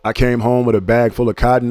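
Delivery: usual speaking voice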